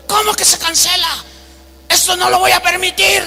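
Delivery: high-pitched mock outrage; acted indignation. Famous preacher hears his flight is cancelled, acted indignation. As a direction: imitate an outraged passenger, voice pushed up.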